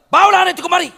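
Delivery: high, loud barked order; snapping command. Shown as a demonstration. Preacher demonstrating how Jesus snapped the order, loud and high. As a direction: bark the command sharply. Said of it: "Harus membentak".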